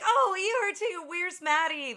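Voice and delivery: high, loud frantic questions; hammed-up alarm, pitched high; high panicked rush; mock panic. Retold movie scene, panicked questions.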